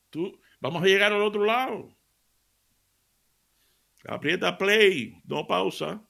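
God shouting. Retold or imitated. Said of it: "nos está diciendo a grito".

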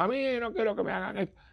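quick, high, whiny grumble; sarcastic imitation of a complainer; mock whiny complaint. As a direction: imitate a petulant parishioner. Mocking grumpy parishioners.

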